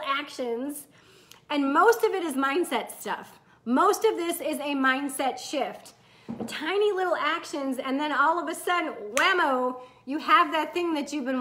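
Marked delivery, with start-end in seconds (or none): none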